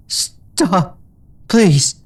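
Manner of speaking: high, pleading moan through pain. A narrator's reading. Injured mare begging rescuer to stop, pained. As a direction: beg her to stop, hurting. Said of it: "she moaned".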